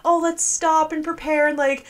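imitating sensible hikers. Player mimics other people preparing to hike, lightly mocking high voice. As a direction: gently mimic careful hikers. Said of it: "While other people are like".

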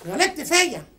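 loud, forceful telling-off; angry confrontation. Acted. Confronting the man who offended, angry and very loud. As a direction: lay into him at full volume.

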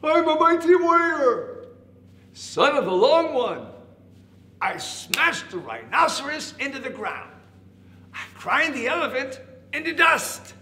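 high, very loud warrior boast; big boastful voice. A narrator's reading. Hidden caterpillar scaring off the elephant, big boastful voice. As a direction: boom out swooping threats to scare them. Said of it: "the voice from inside said".

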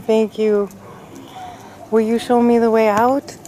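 ordinary talk, nothing marked

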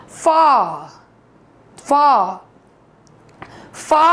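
फा with a long open aa; फा as one drawn-out syllable, no R; open-mouthed AA. Shown as a demonstration. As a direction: open the mouth wide and hold फा. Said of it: "मुंह खुले".

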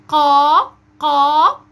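قا loud, long and heavy with an open A; qaf with fatha. Shown as a demonstration. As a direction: model a forceful, sustained قا. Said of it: "بالفتح". (قاف مفتوحة بقوة)

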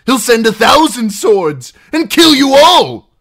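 high, loud panicked threat; failed sternness. A narrator's reading. Captive warning outlaws of his father, failed sternness. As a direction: threaten loudly, voice betraying you. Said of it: "his voice betrayed him".

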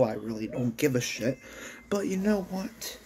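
ordinary delivery, nothing marked

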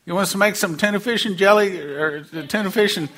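high, fast, exasperated disciple voice. Acted. Disciple pressing Jesus about feeding the crowd, high and fast. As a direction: whine it up high like a fed-up follower.

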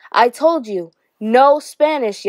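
yelled order. A narrator's reading. Teacher grabbing Ramon by the shoulders, yelling. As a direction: yell it as a stern teacher's warning. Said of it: "yelled the teacher".

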